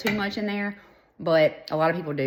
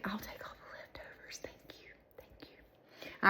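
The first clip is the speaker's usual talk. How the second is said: hushed greedy whisper; 4 semitones higher, 15 dB quieter than usual. Retold or imitated. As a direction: whisper it like a secret. Retold greedy thanks, whispered.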